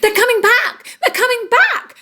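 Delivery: high excited alarm call. Acted. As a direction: cry out the warning high and bright. Birds calling alarm.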